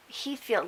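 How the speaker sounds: ordinary talk, nothing marked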